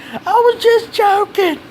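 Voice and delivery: squeaky high, slow pleading voice through a choke; mock the choked kid with a thin strangled squeak; choked bully pleading. Bully pinned by the throat, gasping that it was a joke, in a squeaky high plea. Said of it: "he was like".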